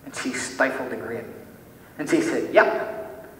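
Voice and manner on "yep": a child's sure, breezy reply, lightly imitated; easy confidence. A little girl answering whether God loves her, confident and easy. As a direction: imitate a little girl's quick certainty. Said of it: "she said it with such ease and confidence and certainty".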